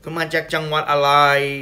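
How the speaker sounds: basic Thai phrases, lightly voiced; chatty tourist Thai; speaking Thai. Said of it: "speaking to them in Thai".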